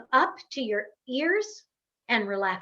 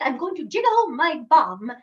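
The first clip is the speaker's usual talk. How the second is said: playful British accent, higher and louder; British dialect; 4 semitones higher, 6 dB louder than usual. Shown as a demonstration. Coach joking about the wiggle exercise, mock British accent. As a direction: put on a British dialect for fun. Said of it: "with a British dialect".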